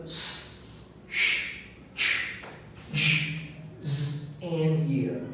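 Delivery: s, sh, ch, j, z, y as separate sounds; pronunciation drill. Shown as a demonstration. Teacher introducing the drill sounds to students, each isolated: s, sh, ch, j, z, y. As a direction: sound out s, sh, ch, j, z, y one by one. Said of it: "we're going to study about pronunciation drill".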